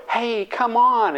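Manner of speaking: drawn-out cheer to follow along; coaxing friends. Retold or imitated. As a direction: egg a friend on down the slope. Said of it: "your friends go".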